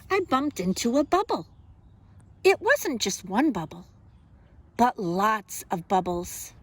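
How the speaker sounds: lively, expressive picture-book reading; reading to children. Acted. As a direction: read it like storytime for little kids.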